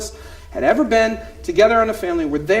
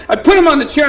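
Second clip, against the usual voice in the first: ordinary delivery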